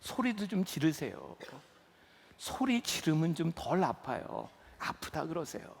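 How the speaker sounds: soft and low, gently urging; calm doctor coaxing a patient; hushed, slow reassurance; quiet doctor's voice. Retold or imitated. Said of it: "의사 선생님분이 저한테 그래요".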